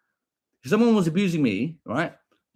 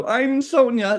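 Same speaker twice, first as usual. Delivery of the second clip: mocking commenter; 9 semitones higher than usual. Parroting the commenter again.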